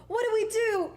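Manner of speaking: high, loud panicked question. Retold or imitated. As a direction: ask it in sudden alarm. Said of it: "I was like".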